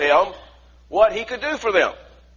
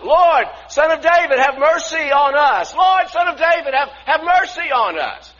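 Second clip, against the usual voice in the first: loud pleading cry, higher; shouting out; 9 semitones higher, 7 dB louder than usual. Retold or imitated. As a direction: cry out for mercy at full voice. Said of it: "they began yelling out".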